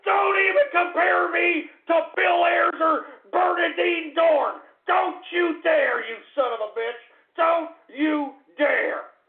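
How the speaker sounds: high, loud screaming rage; real enraged tirade at full pitch; furious screaming. Real, not acted. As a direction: explode at the troll, stretching out the threats. Enraged at a troll's name.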